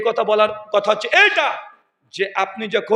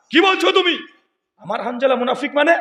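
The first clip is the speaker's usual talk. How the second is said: high, raised voice of disbelief; astonished questioning; 5 semitones higher than usual. Acted. Prophet questioning Abu Bakr about Hanzala, astonished. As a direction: demand an explanation, pitch leaping up. Acted astonished reply to news.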